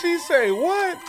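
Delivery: high, loud mock disbelief at the callout; surprised shout. Real, not acted. Host reacting to the guest's bold battle callouts, loud surprised shout. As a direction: yell it like you can't believe she went there.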